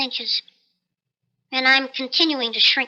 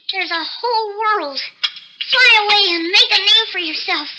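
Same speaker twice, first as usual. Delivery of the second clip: higher and louder, joyful send-off; elated urging; 6 semitones higher, 4 dB louder than usual. Acted. Man urging his parakeet to fly free, higher, elated. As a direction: cheer the bird out into the world.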